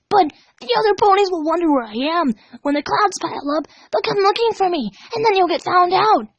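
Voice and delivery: high, pleading and urgent; desperate cry. Acted. Dash desperately warning Pinkie she'll be found. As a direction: grasp at any excuse to be freed. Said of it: "Dash cried in desperation".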